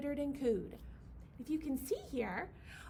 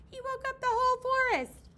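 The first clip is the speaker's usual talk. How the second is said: excited; 11 semitones higher, 8 dB louder, faster than usual. Real, not acted. Excited to children.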